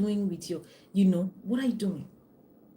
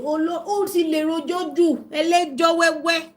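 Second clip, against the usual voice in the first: high, loud exasperated mother lamenting in Yoruba; imitating mom; 9 semitones higher, 8 dB louder than usual. Mom despairing that her talkative daughter talks too much, high and loud. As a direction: cry out like a fed-up Nigerian mother. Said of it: "my mom is saying".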